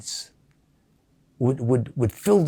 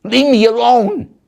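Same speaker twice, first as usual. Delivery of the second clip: imagined scream; 7 semitones higher, 7 dB louder than usual. Retold or imitated. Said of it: "I wanted to get up and scream".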